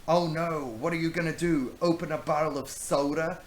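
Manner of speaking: high loud sarcastic mock fear. Brownbeard mocking Rachel's tiny knife, loud sarcastic taunt. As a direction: sneer with fake alarm. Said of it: "he said mockingly".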